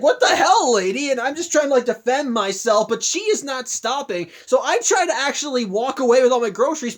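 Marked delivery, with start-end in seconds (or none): 0.0-1.1 indignant yell. Retold or imitated. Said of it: "I'm just like".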